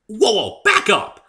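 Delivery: high, loud halting cry; loud startled whoa; calling out. A narrator's reading. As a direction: stop her in her tracks. Davy stopping Pinkie.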